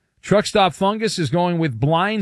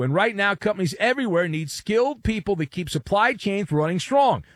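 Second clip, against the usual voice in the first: upbeat sponsor read, pitched up; 6 semitones higher than usual. Acted. Host reading a sponsor ad in a pitched-up ad voice. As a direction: sell it like a radio spot.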